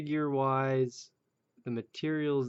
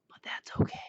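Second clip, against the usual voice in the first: whisper; faster than usual. Real, not acted. Creepy aside to viewers.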